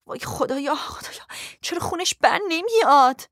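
gasped plea rising to a cry; frantic, breathless distress; panicked. Acted. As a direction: start under the breath, burst out high. Panics over bleeding that won't stop.

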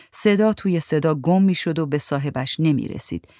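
plain reading voice

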